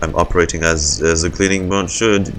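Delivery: ordinary delivery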